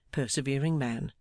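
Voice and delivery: usual speaking voice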